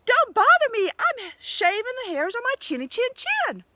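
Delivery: high, loud rude yell; yelled back. A narrator's reading. Pig yelling at the wolf from his stick house, high and loud. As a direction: shout him away from inside. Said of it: "yelled back".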